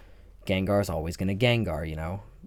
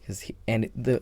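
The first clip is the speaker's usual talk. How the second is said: ordinary talk, nothing marked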